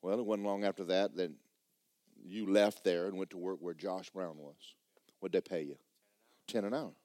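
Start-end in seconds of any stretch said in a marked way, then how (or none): none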